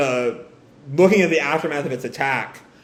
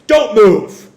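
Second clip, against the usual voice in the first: loud, high acted command; shouted warning; 6 semitones higher, 10 dB louder than usual. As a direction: bark an order at gunpoint. Gunman barks order while firing.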